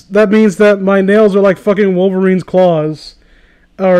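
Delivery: ordinary talk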